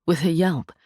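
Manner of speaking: ordinary delivery, nothing marked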